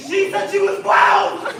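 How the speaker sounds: high, loud, slow protesting yell; desperate shout. Acted. Defends himself in skit quarrel.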